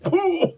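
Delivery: a high, hammed-up "ooh"; mock pained yelp. Blade pinned by Thor's hammer, mock pained yelp. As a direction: squeal as the hammer lands.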